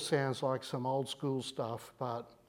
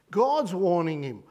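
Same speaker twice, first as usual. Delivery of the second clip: ordinary talk, nothing marked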